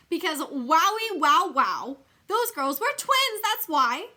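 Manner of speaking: loud, high, wowed and slowed; wowie, wow, wow, shout your amazement; thrilled. A narrator's reading. Junie B thrilled at spotting the twins, loud excited cry.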